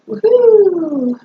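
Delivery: high cheerful woohoo; excited whoop. Real, not acted. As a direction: whoop with a burst of glee. Cheering as she wheels away.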